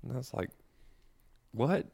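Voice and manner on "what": usual speaking voice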